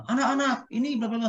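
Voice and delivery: high, sing-song teacher call with strong emphasis; lively intonation. Shown as a demonstration. Teacher calling the class, pitched high and emphatic. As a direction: show how to stop sounding flat. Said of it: "Bapak ngomongnya datar".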